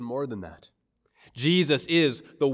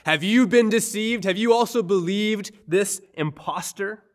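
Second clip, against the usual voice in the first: higher and louder, sneering down at them; a Pharisee mocking the officers, condescending sneer; 7 semitones higher, 6 dB louder than usual. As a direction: scoff at the guards as fools for believing. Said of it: "the condescending, sneering accusation".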